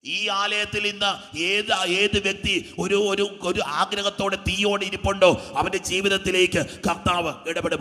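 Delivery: loud, slow, emphatic proclamation; booming, measured sermon climax; preaching at full force. Real, not acted. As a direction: declare it over the whole congregation.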